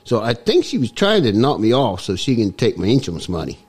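comic mock-Cockney accent; put-on Cockney accent. Guest explains his voice to the host, put-on Cockney accent. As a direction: talk in a hammed-up Cockney accent. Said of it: "it's a little bit Cockney".

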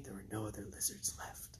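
hushed, breathy storyteller's hush; dramatic whisper. Pretend. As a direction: drop to a whisper for the punchline. Dramatic ending of the recital.

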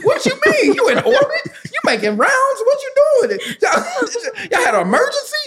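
high-pitched laughing mock confusion; laughing disbelief. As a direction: pile on the questions, cracking up. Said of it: "I was like".

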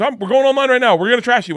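a high loud gloating threat; gleeful threat. Retold or imitated. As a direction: gloat like you're about to roast him. Big Jay threatening to trash.

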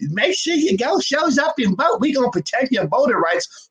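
high, hammed-up shouting politician voice; mocking politician. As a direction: sneer at the condescending politician, way up high. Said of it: "And you up here like".

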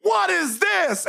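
high, slow incredulous squeak; mock outrage. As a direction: squeal it in disbelief, drawn out. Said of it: "he goes".

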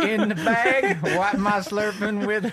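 high, slow laughing Texan twang; hammed-up Texan song lyric; Texan drawl song. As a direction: sing the lyric in a thick Texan accent. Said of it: "the lyrics to the next song".

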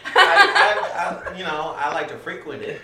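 laughing. Real, not acted. Flustered laughing excuse.

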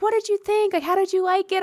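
high-pitched excited imitation; eager question. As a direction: imitate a star keen to hear back. Voicing actress eagerly asking fan.

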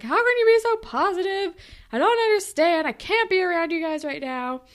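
high, loud agitated mock of Anya; a high, frantic imitation of Anya; mock distressed outburst. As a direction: blurt it out upset.

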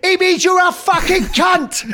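high-pitched yell of a fed-up fan; imitated furious terrace yell; exasperated shout. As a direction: explode as if you've finally had enough, imitating a supporter. Acts out fed-up English fan.

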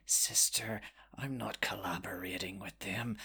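hushed, whispery creature voice, rising at the end; Salazar. Acted. Tree mollusk answering the sister's accusation, hushed then rising. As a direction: start in a whisper, climb to a threat. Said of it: "like, says".

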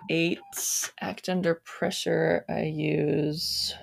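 normal talking voice

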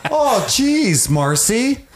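high, dismayed Minnesota "oh, jeez"; hammed-up Minnesota fussing in a high voice; Minnesota accent. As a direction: squeal it like a flustered Minnesotan. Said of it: "why he's from Minnesota now".

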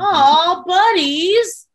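high, loud gushing coo; playful drawn-up "aww" voice; cooing fondly. Retold or imitated. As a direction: gush over an adorable friendship. Fond reaction to chefs' friendship.

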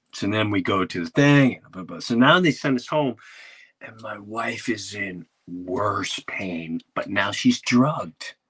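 ordinary talk, nothing marked

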